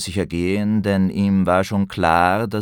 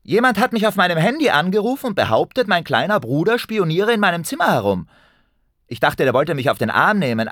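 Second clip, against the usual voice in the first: angry sister; 7 semitones higher, 3 dB louder than usual. Acted. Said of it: "der wütenden Ute".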